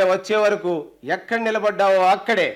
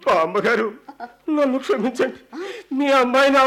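higher, broken whimper after a beating; whimpering plea; 4 semitones higher than usual. Acted. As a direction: plead like someone just thrashed. Beaten thief begs grandmother's pardon.

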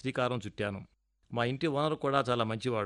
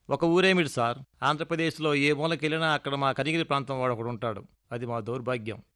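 plain reading voice

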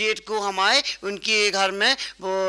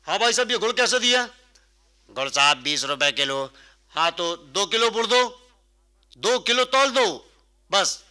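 a put-on customer voice, asking politely for jaggery; lightly voiced polite shopper; customer voice demo. Shown as a demonstration. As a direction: show him how a proper buyer asks. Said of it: "देखो कैसे बोलते हैं".

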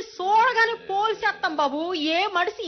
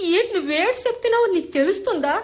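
usual speaking voice

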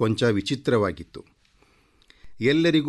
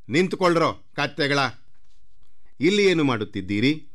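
quick, higher scolding; brisk acted reprimand, pitched up; scolding order; 5 semitones higher, faster than usual. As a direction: rap out a rebuke to servants. Royals scold the lowly servants.

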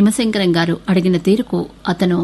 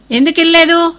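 higher, louder demand; father snapping the question at his son; angry father repeating the headmaster's question; sharp angry repeat; 10 semitones higher, 6 dB louder than usual. A narrator's reading.

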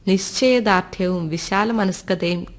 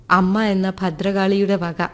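ordinary delivery

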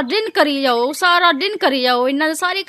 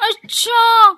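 offended surprise; 5 semitones higher, 4 dB louder, much slower than usual. Acted. Offended by friend's remark.

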